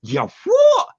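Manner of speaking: very high, loud shocked squeal; shocked shriek. Retold or imitated. Wife hearing her husband quit, shrieking in shock. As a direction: shriek in disbelief. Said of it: "And she".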